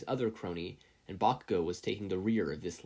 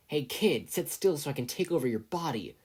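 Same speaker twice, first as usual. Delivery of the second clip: scratchy yell; 5 semitones higher, 3 dB louder than usual. A narrator's reading. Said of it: "a scratchy voice yelled out".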